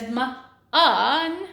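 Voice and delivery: "m-un" slowly sounded out, m then a stretched un; phonics-style "m-un", the vowel and n held; sounded-out syllable. Shown as a demonstration. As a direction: draw out "m-un" in two separate parts. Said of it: "my first syllable says".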